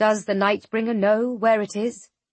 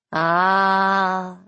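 knowing, dark amusement; amused djinn voice; 3 semitones lower than usual. Acted. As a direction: savour the moment. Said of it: "he observes".

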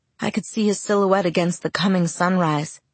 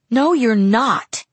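louder, very slow shout; 5 semitones higher, 5 dB louder, much slower than usual. Acted. Bella jumping up at Jacob, a slow loud shout. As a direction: hammer each word at him. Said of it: "I shouted".

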